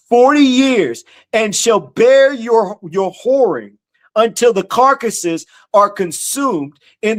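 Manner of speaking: slow, high, ringing scripture reading; preaching scripture. Acted. Preacher reading God's words to the congregation, slow and ringing. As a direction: proclaim each phrase slowly as the voice of God.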